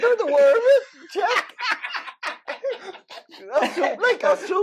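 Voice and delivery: can't stop laughing while insisting; laughing protest. Real, not acted. Laughing defence continued.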